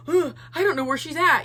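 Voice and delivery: a mildly panicked, put-on gasp and fret; retold freaking out. Chad freaking out over the missing girl, mock panic. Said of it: "was freaking out and being like".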